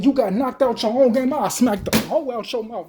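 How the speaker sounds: normal talking voice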